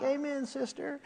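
soft, dry mock agreement; sarcastic amen. Pastor mocking his zealous friend, dry and soft. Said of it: "I'm like".

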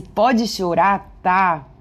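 slow, savoured repeat of pode chorar, tá?; bem devagar. Shown as a demonstration. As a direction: linger on every word of "pode chorar, tá?". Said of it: "é incrível". (repetindo "pode chorar, tá?" bem devagar, saboreando)

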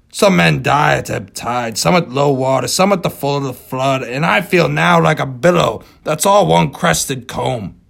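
put-on weary old captain. Ahab's farewell speech.

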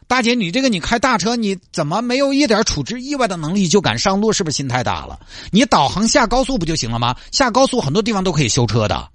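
high-pitched, incredulous scolding; exasperated traffic officer. Acted. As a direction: lecture a helpless driver in disbelief. Acts exasperated officer questioning driver. (尖着嗓子不耐烦地数落)

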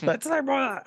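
high, raspy goofball creature; silly high alien rasp; Jar Jar Binks. Acted. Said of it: "something more raspy".

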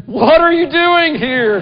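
put-on high, loud, slow exasperated yell at the kids; frustrated shout. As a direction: bellow it at the boys in the toilet, dragging the words. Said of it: "sometimes it can be frustration".

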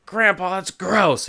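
put-on high, groaned kid complaint; imitated childish revulsion; mock disgust. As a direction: complain like a grossed-out grandkid. Kids disgusted at grandpa's fart.

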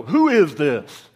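loud, slow mock question; mock non-recognition. Answering a spouse's phone call, loud mock non-recognition. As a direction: draw it out loudly, as if clueless.

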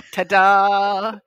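loud, drawn-out mock reveal; playful ta-da. Host jokes that happiness is back, playful ta-da. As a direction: present it like a little magic trick, loudly.

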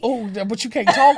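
ordinary talk

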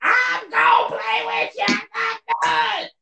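very high, sing-song promise to his son. Real, not acted. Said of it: "Y'all just heard me tell my little man".

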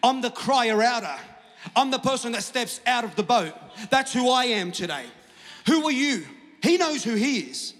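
loud, high, building revival preacher; rally the congregation, climbing to a shout; full-force preaching. Real, not acted.

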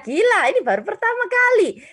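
high and loud, bursting with excitement; excited exclamation. Real, not acted. Preacher opening the sermon to the youth, excited. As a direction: exclaim it like it's brand new.

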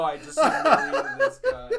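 high laughing squeal. Real, not acted. Laughing at own story.

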